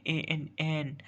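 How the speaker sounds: ए एन एन, the letter sound n said on its own, twice; teacher sounding out ए एन एन for the class. Shown as a demonstration. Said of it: "एक एन sound है".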